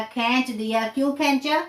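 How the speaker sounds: normal talking voice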